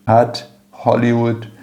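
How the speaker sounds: "Hat" with a breathy H as in "Hollywood"; H aspirated as in English "Hollywood". Shown as a demonstration. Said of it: "como você pronuncia Hollywood".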